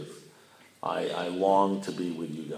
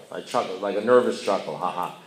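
ordinary talk, nothing marked

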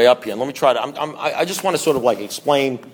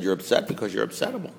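softer, run together without pauses; understated repeat; 2 semitones lower, 8 dB quieter than usual. Retold or imitated. Man repeating his sponsor's verdict after laughter, understated. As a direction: toss the verdict off quietly in one go.

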